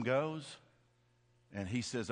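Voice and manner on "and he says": ordinary talk, nothing marked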